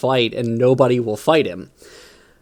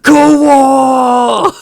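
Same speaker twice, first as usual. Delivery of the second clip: much higher, loud cheering shout; whole mob roars it together; orcs cheering; 12 semitones higher, 9 dB louder than usual. Retold or imitated. Five orcs embracing the new word, loud cheer. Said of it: "all five of them are just".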